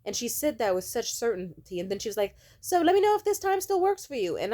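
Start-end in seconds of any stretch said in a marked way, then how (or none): 2.6-4.4 high, loud fake-sweet voice; mocking manager. Mocking the manager's follow-up text in a high, loud fake-sweet voice. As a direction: ham up her passive-aggressive politeness.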